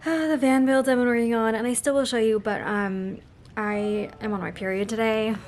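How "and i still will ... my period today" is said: ordinary delivery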